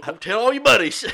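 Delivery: high, loud playful outcry; mock protest. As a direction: cry out in pretend embarrassment. Mock scolding over embarrassing story.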